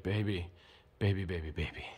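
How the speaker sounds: low, hushed seducer; soft, deep smooth-talker voice; Rico Suave. Shown as a demonstration. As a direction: murmur it low like a smooth lover. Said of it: "whispering and trying to be, like, Rico Suave".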